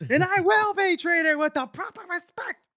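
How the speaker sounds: high, loud cracking demand. Retold or imitated. Drunk king demanding respect, voice cracking high. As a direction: squeak up mid-demand. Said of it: "his voice is cracking".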